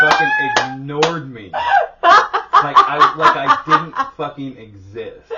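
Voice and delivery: high, loud, slow, through laughter; can barely get it out for laughing; squealing laughter while telling it; laughing hard. Real, not acted. Laughing indignant retelling.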